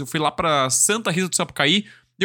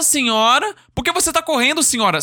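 lightly mimicked insistent reporter, rising calls; 6 semitones higher than usual. Reporter calling after a fleeing lady, mimicked insistent calls. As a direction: call after someone, pitch climbing, half acted.

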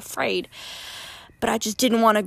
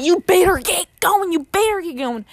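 urgent warning; 6 semitones higher, 4 dB louder than usual. Retold or imitated. Man urging her to leave.

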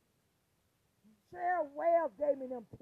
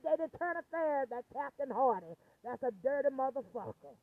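ordinary talk, nothing marked

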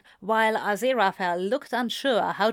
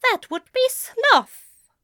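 prim, higher-pitched, slow reproof; snippy; 6 dB louder, slower than usual. A narrator's reading. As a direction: rebuke it snippily, drawn out. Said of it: "Aziraphale said snippily".

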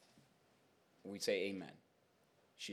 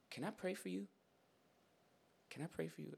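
soft, raised, careful question; tentative offer; 7 semitones higher, 11 dB quieter than usual. Retold or imitated. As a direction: ask gingerly, high and quiet. Cautious offer to hostile woman.

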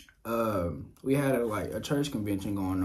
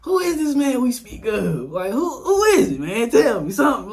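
high and loud, playfully insistent; excited teasing demand; 12 semitones higher, 9 dB louder than usual. Retold or imitated. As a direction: press mom for the name. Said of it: "I'm like".

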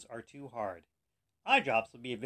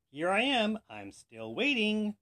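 high, loud singsong taunt; 7 semitones higher, 4 dB louder, slower than usual. A narrator's reading. Professor taunting God as the clock ticks, jeering. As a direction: goad them, stretching it out. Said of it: "the professor kept taunting God".

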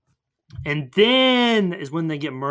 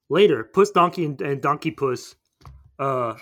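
usual speaking voice

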